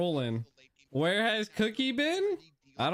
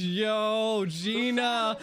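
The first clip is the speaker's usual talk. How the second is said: ordinary talk, nothing marked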